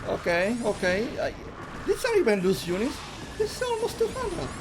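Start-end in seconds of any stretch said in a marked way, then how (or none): 1.9-4.5 excited. Real, not acted. Caster hyped by a big army.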